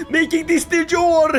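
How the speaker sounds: high laughing cry; gleeful crowing. Real, not acted. Player making the conquest de jure, gleeful crowing. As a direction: crow it through laughter. Gloating over empire.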